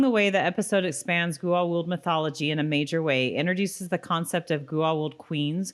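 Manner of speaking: steady read-aloud of a written episode review. Acted. Wife reading out the episode review, in a steady read-aloud voice. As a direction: read it out like a polished summary.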